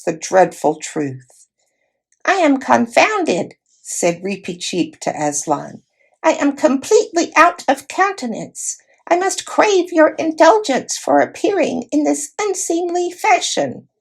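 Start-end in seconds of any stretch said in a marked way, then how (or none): 2.2-3.6 high squeaky voice of a dignified little mouse; a tiny knight, formal and put out; proud mouse knight. A narrator's reading. 6.2-13.9 high squeaky voice of a dignified little mouse; a tiny knight, formal and put out; proud mouse knight. A narrator's reading.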